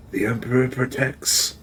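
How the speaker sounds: a rasping, cracked priest's voice, held back. Acted. Gaunt priest greeting the newcomer, in a cracked voice. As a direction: croak it out like a gaunt old priest. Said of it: "In a cracked voice".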